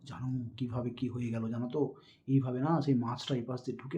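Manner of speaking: put-on teacher telling children a story, hushed; hushed storytelling demo. Shown as a demonstration. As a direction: draw children in with a secret-telling hush. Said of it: "আবার কখনো".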